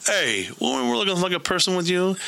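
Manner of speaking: high, light mock Tyrion voice; Tyrion quip. Tyrion teasing Davos on the dock, high and sly. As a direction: toss it off like a sly little jab. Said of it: "Tyrion walks by him and is like".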